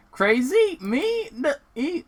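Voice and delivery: high, squeaky disbelief; defensive patient. Acted. Sonic as patient denying he's crazy, high and squeaky. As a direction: act shocked at the idea. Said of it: "played the role of patient".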